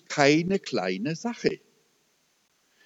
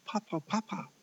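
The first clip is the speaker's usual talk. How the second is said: hushed, higher-pitched urgent calling; excited retelling; 5 semitones higher, 15 dB quieter than usual. Retold or imitated. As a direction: imitate her agitated call very softly. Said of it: "ganz aufgeregt".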